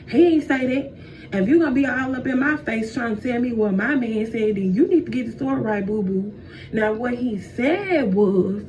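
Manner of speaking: indignant, stretched-out setting straight; annoyed. Acted. Eve defending her man to the snake, annoyed. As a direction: correct him with attitude, leaning hard on key words. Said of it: "Eve got annoyed".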